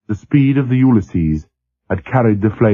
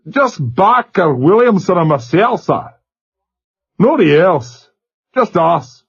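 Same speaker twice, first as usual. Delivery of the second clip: put-on high young Scot, steady; a shaken lad listing names; Glasgow accent; 9 semitones higher than usual. A narrator's reading. Glasgow seaman.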